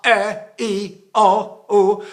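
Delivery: sung vowels each started with a hard glottal click; loud sung vowels with hard glottal onsets; glottal stroke vowels. Shown as a demonstration.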